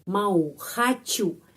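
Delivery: ordinary talk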